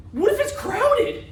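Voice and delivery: very high, slow fretting; exaggerated high-pitched mock panic; mock alarm. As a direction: squeal a hammed-up worry, stretched out. Acting out a silly worry.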